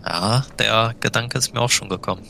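ordinary talk, nothing marked

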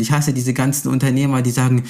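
ordinary talk

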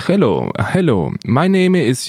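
put-on English in a light Russian accent; slow "Hello, hello" in a Russian accent; accidental Russian accent slipping in. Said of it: "jetzt bin ich immer im russischen Akzent".

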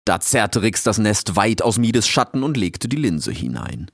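ordinary delivery

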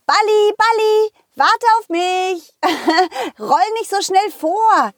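high, calling after a runaway ball; playful high call. Acted.

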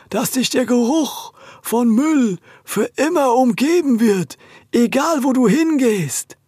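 high, slow hollow curse; hollow ghost voice. Acted. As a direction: lay the curse slowly like a ghost. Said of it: "mit hohler Stimme".

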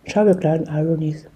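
low sultry voice, mouth full of tomato; sexy voice. Pretend. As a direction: purr the goodbye low and sexy. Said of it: "ich möchte mit meiner sexy Stimme was sagen".

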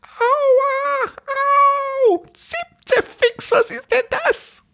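very high, tiny creature voice squeaking in pain; tiny squeaky voice. Acted. Tiny creature behind the door, squeaking very high. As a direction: pitch it way up like a tiny being yelping. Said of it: "ganz leise eine Stimme".

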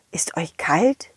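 soft whispered question. Acted. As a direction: whisper gently to scared shoes. Colin fragt zitternde Schuhe. (geflüstert)

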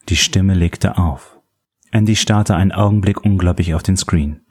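usual speaking voice

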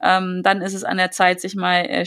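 ordinary delivery

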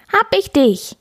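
high, loud gotcha pounce; playful kitten catching its prey; little tomcat crows in triumph; triumphant kitten. Acted. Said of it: "miaut er".

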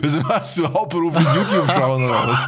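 laughing in disbelief. Real, not acted. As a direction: talk through the laugh. Reacting to six hours watch time.

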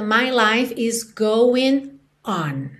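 slow line with a break before ON; separated final words. Retold or imitated. Teacher quoting the song's last line, on set apart. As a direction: draw out "going", pause, then land ON. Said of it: "na última vez de todas, ela canta".

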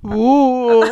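spooky ghost moan; a drawn-out ghostly oh; ghostly wail. Pretend. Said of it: "die Geisterstimmen".

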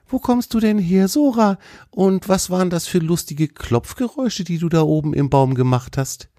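high, inquisitive deer voice; curious friendly deer. Acted. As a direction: question a new friend in a high animal-character voice.

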